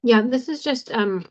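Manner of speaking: talking around an ice cube; words slurred by ice; ice in mouth. Real, not acted. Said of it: "sorry, I'm eating ice cube".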